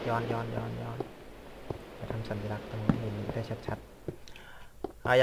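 hushed whisper while creeping back through the cave. Real, not acted. Player sneaking back through the cave, whispering to himself. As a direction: keep it to a breath, just whispering.

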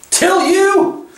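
very high, shrill witch cry; suddenly shrill. A narrator's reading. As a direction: shriek it back in outraged disbelief. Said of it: "her voice growing suddenly shriller".

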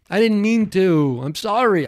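loud, high plea of guilt; mock distraught apology. Man apologizing for eating bug brains, mock distraught. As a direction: wail it with hammed-up remorse. Mock remorse over swallowed bugs.

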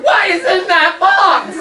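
very high, slow, loud demand; alarmed mom. Retold or imitated. Mother hearing a meow from the box, shocked. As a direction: ask in shock. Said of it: "She said".

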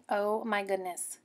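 normal talking voice

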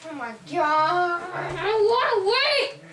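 high loud whining yell; raging gamer. Real, not acted. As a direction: tantrum shouting.

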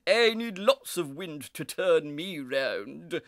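loud, scornful squawk of a voice; scoffing cockerel. A narrator's reading. Cockerel mocks the little breeze, scoffing. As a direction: belittle the listener with drawn-out disdain. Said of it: "scoffed Coco the Cockerel".

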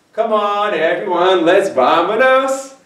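loud, high cartoon cheer; Dora. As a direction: cheer like a kids' show host.

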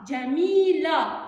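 جميلة said slowly and loudly, syllables drawn out. Shown as a demonstration. Teacher sounds out what remains after erasing a syllable. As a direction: stretch جميلة out for the class. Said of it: "تبقى".

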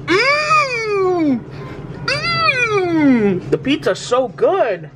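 high hummed mm, then slow high voice; seagull voice. Acted. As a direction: voice the bird enjoying its food. Voicing the seagull eating.

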